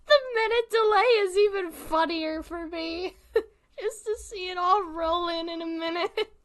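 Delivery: high voice squeezed by laughter; tearful laughter. Real, not acted. Streamer watching delayed chat reactions roll in, laughing. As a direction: talk on while cracking up.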